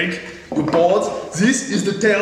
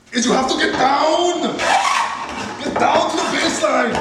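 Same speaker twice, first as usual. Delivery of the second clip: higher, half-laughing shout of get down; mock battle shout; 5 semitones higher than usual. Woodworker psyching himself up at the saw, hammed-up shout. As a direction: hype yourself up like a war cry.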